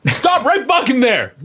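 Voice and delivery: high, loud, drawn-out yell; outraged mock shout. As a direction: halt her with a hammed-up, slow shout. Acted outburst over cottage cheese.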